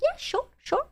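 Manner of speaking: easygoing mock reply. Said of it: "I would have been like".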